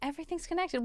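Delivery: soft, high, breathy mystic; hushed mystical. Pretend. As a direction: whisper it like a revelation.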